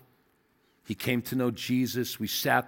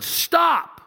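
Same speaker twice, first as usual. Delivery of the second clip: sudden high loud STOP; a commanding cry that halts a cyclist; divine shout; 5 dB louder than usual. Retold or imitated. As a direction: bark out Stop like a voice from heaven. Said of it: "the Holy Spirit just shouted".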